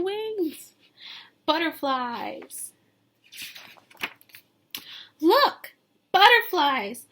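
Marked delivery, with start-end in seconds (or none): 5.2-7.1 loud, high, thrilled look; excited exclaim. Acted. Teacher reading the book's opening to children, excited. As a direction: call it out like spotting them.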